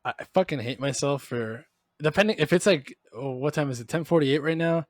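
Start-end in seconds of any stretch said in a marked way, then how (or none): none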